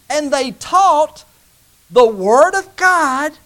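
preacher rising to the sermon's climax; building preaching. Acted. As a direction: build to a soaring finish.

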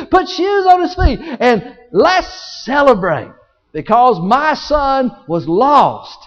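high, loud, stretched-out words; jubilant proclamation. Acted. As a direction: proclaim the celebration, slowing for weight. Father orders a celebration.

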